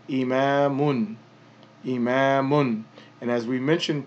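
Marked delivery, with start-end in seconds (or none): 0.1-1.1 "imamun" with the case ending un fully sounded. Shown as a demonstration. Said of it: "so that would be". 1.8-2.8 "imamun" with the case ending un fully sounded. Shown as a demonstration. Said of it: "so that would be".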